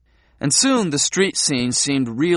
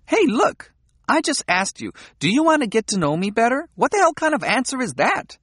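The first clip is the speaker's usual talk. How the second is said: indignant Midori; 10 semitones higher than usual. A narrator's reading. Midori scolding, pitched high.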